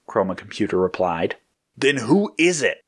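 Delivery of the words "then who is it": raised, demanding question. A narrator's reading. Red pressing the computer for the leader, demanding. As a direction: demand an answer, pitching it up. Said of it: "Red demanded".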